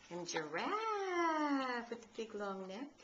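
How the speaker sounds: sing-song picture-book voice for a little one; reading to a baby. Acted. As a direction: read it brightly to a toddler. Grandmother reads picture book to baby.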